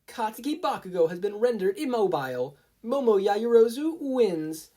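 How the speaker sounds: Midnight announces the winner to the crowd, loud; announcer voice. A narrator's reading. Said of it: "Midnight announced".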